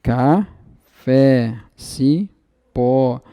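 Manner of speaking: normal talking voice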